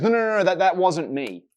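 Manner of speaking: high, loud flustered denial; Peter, cornered by the girl, denies it in a high panicked rush. Retold or imitated. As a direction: blurt it out, caught and scrambling. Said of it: "Peter goes".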